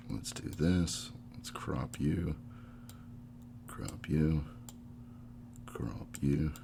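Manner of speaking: slow, hushed, low murmur; absent-minded self-talk. Real, not acted. Host cropping a guest's video feed, murmuring to self. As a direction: talk softly to yourself as you work.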